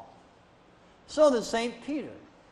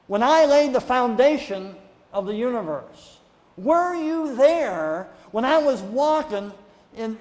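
God demanding answers, building louder and higher; booming God; 4 semitones higher, 3 dB louder than usual. Retold or imitated. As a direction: thunder it down at Job like God himself. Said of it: "And so what did God tell Job?".

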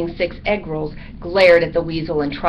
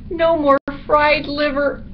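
high, slow bat lament; woeful little bat; 9 semitones higher than usual. A narrator's reading. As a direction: draw out the woe up high. Bat continues list of lost meals.

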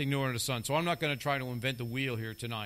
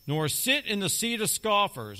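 higher, louder scripture reading; scripture proclaimed; 7 semitones higher, 4 dB louder than usual. Acted. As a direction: proclaim the verse to the church.

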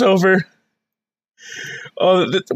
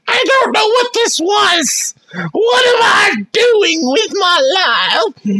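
mock wail; 8 semitones higher than usual. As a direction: wail it in fake despair.

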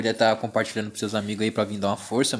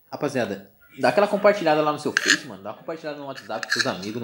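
ordinary talk

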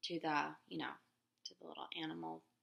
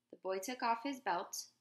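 usual speaking voice

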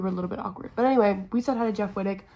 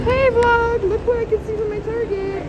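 excited vlog greeting; 10 semitones higher than usual. Real, not acted.

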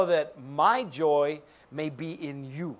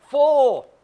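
very loud, high "full"; full-force preaching; preaching shout; 8 semitones higher, 14 dB louder than usual. Real, not acted. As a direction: belt the last word. Preaching climax.

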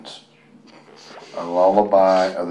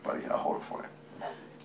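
whisper; 19 dB quieter than usual. Real, not acted. Helping the child with the microphone.

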